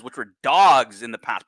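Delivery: ordinary delivery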